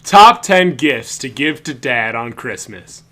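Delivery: loud, slow countdown host voice; hammed-up host voice reading a top ten list; announcer countdown. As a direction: belt it out like a radio countdown. Quoting Christmas special bit.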